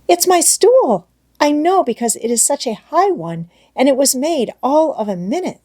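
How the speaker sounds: put-on high child voice, full of wonder; a little girl amazed at her new stool; excited little girl. A narrator's reading. Heidi's voice in story.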